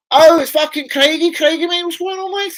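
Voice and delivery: light up when you spot a mate; real delight calling a friend; excited greeting. Real, not acted. Greeting a viewer in chat.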